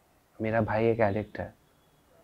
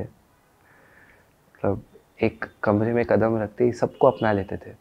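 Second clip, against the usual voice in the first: normal talking voice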